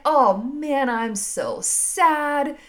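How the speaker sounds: drawn-out, whiny mock sulk; sulking over her sadness, drawn out and mock sad. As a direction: ham up a slow self-pitying moan. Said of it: "you just let".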